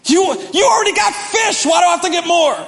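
high, loud, baffled protest; exasperated complaint. Retold or imitated. Peter questioning Jesus about the fish, high exasperated protest. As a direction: protest in disbelief.

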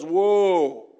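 loud, drawn-out mock whoa; alarmed balk. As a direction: pull back hard at the news. Ananias balks at hearing of Saul.

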